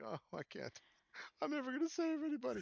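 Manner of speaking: high, fast mock whine; mock crying. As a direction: imitate her whimpering. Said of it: "I just heard you crying about it".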